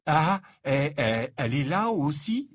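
hesitant, slightly hoarse question; faltering question. A narrator's reading. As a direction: stammer it out a little hoarsely. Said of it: "demanda Harry d'une voix rauque".

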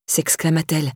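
ordinary delivery

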